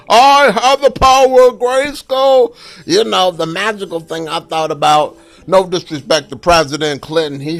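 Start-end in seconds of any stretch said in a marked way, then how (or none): 0.1-2.5 louder hammed-up cartoon hero cry, slowed; imitating He-Man. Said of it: "look like Skeletor".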